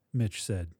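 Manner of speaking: ordinary delivery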